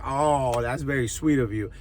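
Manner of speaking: low, soft mock tenderness; softly cooed, sarcastic appreciation; mock-touched girlfriend. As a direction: act sweetly moved, low and quiet. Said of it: "Your girl ain't gonna be like".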